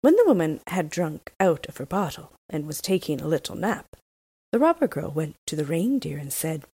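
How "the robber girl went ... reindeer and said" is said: ordinary delivery, nothing marked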